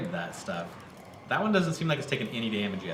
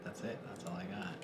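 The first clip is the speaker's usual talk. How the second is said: whispering; 4 semitones lower, 12 dB quieter, faster than usual. Real, not acted. Admits defeat, trailing off.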